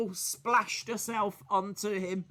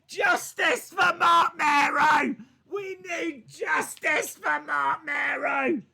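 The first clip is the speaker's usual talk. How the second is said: loud, high shouted protest; real indignant yelling, louder and slower; outraged shout; 10 semitones higher, 5 dB louder than usual. Real, not acted. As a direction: cry out for justice, dragging it out. Live reaction to headbutt.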